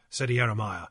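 ordinary delivery

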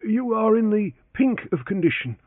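high, deferential servant voice; humble old valet answering his master; elderly valet Parker. A narrator's reading. Valet corrects himself.

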